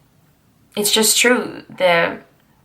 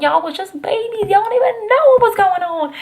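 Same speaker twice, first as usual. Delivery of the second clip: much higher, teasing "y'all was just babies"; mock old-timer sass; 13 semitones higher than usual. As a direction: tease the kids like a know-it-all elder. Teasing the young viewers.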